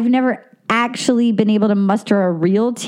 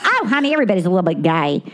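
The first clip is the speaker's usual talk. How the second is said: Southern accent; 5 semitones lower, much faster than usual. Shown as a demonstration. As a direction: toss it off like a Southern belle. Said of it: "What's your Southern?".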